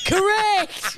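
high, loud game-show "Correct!"; mock triumphant. Teasing a friend with a mock shout of joy. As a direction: fake a big win. Sarcastic fake confirmation.

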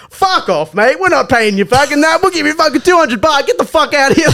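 high-pitched indignant tirade; mock angry uncles. Uncles refusing to pay the driver, mock angry. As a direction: imitate relatives seeing someone off.

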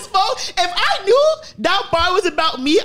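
worked up and loud; excited. Real, not acted. Excited, higher, laughing.

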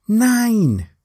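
high excited shout of the number; called-out count. Retold or imitated. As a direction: call it like a cheering crowd.

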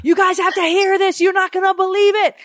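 high-pitched imitation of an excited yell; excited screaming. Retelling Jason screaming from the office, excited high scream. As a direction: shriek it like calling everyone over. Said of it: "Jason was screaming from the office".

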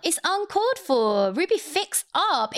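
high, slow mock scolding. Real, not acted. Friend scolding a reality star, high and drawn out. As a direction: scold her like a disappointed aunt.

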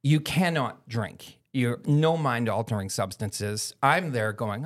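normal talking voice

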